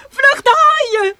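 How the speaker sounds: high, loud, slow cry of fear; terrified warning. Acted. Wife screams a warning about the sharks, terrified. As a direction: warn of the sharks.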